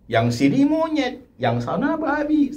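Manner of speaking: a lightly acted high-pitched exchange of name-calling; half-hearted imitation of bickering spouses; mock insults. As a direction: toss the insults back and forth.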